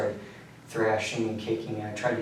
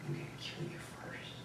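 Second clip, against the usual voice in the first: whisper; 15 dB quieter, faster than usual. Retold or imitated. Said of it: "he said something along the lines of".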